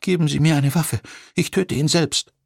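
put-on high, girlish, fierce demand; scared girl trying to sound brave; defiant young woman. A narrator's reading. November demands a weapon.

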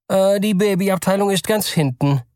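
polite clerk. Acted. Store clerk asks the nervous father, polite and high.

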